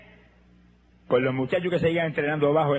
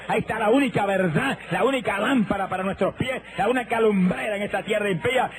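fiery preaching; 4 semitones higher than usual. Acted. Preacher urging the congregation to buy a Bible, fiery preaching. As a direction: preach it at the crowd like a revival.

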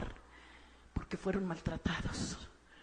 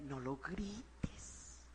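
soft calm advice; 5 semitones lower, 13 dB quieter, much slower than usual. Shown as a demonstration. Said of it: "háblale con calma".

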